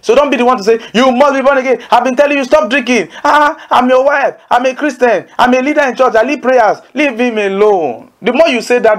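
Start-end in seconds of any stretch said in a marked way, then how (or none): 0.9-6.8 lightly voiced scolding wife, pitched higher; a hint of a preachy, nagging wife.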